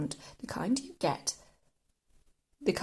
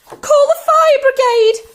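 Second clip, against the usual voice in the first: high, very loud alarm cry; sudden shout; 15 dB louder than usual. A narrator's reading. Grandma burning from the medicine, sudden high shout. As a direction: shriek for help at full volume. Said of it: "she shouted suddenly".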